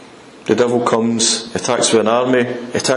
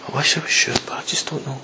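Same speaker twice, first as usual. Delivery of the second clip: quiet, fading admission; deflated doubt; 6 dB quieter, faster than usual. Acted. Believer unsure God will help, quiet deflated doubt. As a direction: trail down almost to nothing, giving up. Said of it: "never experienced that".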